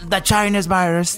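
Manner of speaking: lightly voiced English Trump mimic; mocking Trump. Mocking Trump saying the Chinese virus, lightly mimicked. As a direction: parody the phrase in a hint of Trump. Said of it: "Y dicen".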